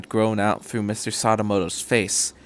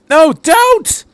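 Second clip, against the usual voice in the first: much higher, louder desperate shout; 10 dB louder than usual. A narrator's reading. Doctor shouts at Miss Miyamura not to shoot. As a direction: try to stop her firing. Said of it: "the doctor shouted".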